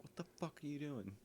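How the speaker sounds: high, hushed imitation of an angry stepmom; mock pissed-off. Stepmom opens the door on him, mock anger. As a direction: imitate her outrage in a high, soft voice. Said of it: "she gets all pissed off".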